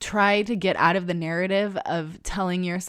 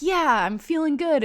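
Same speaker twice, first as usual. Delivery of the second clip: higher, excited imitation of herself; upbeat self-pep; 9 semitones higher, 3 dB louder than usual. Listening back to her own excited voice note, upbeat imitation.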